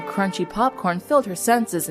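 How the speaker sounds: plain reading voice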